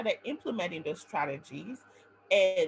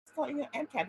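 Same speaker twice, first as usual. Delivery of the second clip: teasing mentor; 7 semitones higher than usual. Retold or imitated. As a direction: tease her with a sly higher voice. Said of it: "when she was like".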